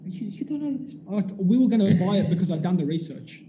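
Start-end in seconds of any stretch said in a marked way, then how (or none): 0.1-0.9 incredulous. Retold or imitated. Shocked seller doesn't know product.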